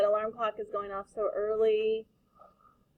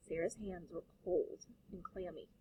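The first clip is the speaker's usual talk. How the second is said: soft, savoured read; 3 semitones lower, 12 dB quieter than usual. Shown as a demonstration. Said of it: "I love that description".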